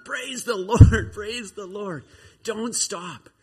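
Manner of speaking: high-pitched shout, loud burst first; yelling. Acted. Encouragement yelling at stumbling Josiah to keep going, acted shout. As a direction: holler slow, stretched encouragement from above. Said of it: "He's just yelling at him".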